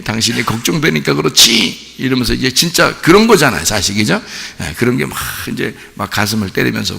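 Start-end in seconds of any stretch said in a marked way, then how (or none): 0.0-2.0 gently chiding wife; softer worried plea. Acted. Worried wife to husband, softened gentle reproach. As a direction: say it more tenderly, still a little worried. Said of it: "더 부드럽게".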